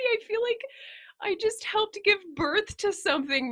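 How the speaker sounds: high, breathy, almost crying whisper; teary whisper. Real, not acted. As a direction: whisper it, overcome with joy. Emotional whisper about the collab.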